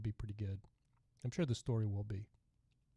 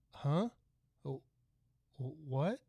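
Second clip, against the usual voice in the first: higher questioning pitch, acting baffled; imitated puzzlement; 4 semitones higher than usual. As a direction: act out not understanding a thick accent. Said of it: "'Cause I could not understand".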